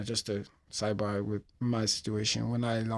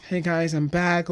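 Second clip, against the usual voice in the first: higher, louder happy greeting; boy calling out to family; cheery hello; 6 semitones higher, 6 dB louder than usual. Retold or imitated. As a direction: burst in glad to be home.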